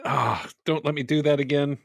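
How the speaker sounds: normal talking voice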